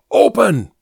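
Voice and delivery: high, loud urgent "Open!"; shouted command. A narrator's reading. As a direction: bark it at the doorman. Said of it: "I shouted in French".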